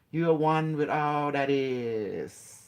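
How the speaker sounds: slow, airy yoga-class delivery; yoga teacher. Pretend. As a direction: draw it out like a yoga instructor.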